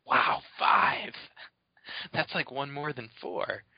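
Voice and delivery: slow, breathy, mock-amazed kid voice; awed little kid. A kid marvels at his mowing pay, slow mock wonder. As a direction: marvel at it like a naive child. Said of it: "You're like".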